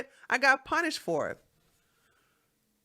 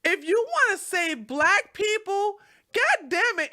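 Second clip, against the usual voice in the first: much higher, louder ranting with a laugh-cry; getting angry; 12 semitones higher, 5 dB louder than usual. Real, not acted. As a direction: boil over, voice cracking between laughter and rage. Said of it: "I'm getting angry".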